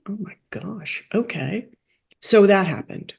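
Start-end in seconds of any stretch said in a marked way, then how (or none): 0.1-1.7 whispered inner amazement; to myself. Retold or imitated. Hearing he will be paid, whispered disbelief. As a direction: breathe it out in disbelief. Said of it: "I said to myself".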